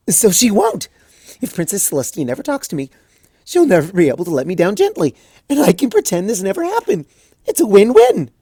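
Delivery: high, loud, giddy burst; manic cheer. Acted. Twilight announcing her plan, high manic cheer. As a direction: flip to wild glee instantly. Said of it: "manic cheer".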